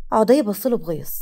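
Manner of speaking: usual speaking voice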